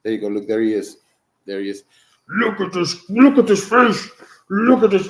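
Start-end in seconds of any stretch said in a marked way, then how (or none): 2.3-5.1 higher, louder gushing at the dog's face; higher, louder doting gush. Real, not acted. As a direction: show off the pup delightedly. Showing dog to camera.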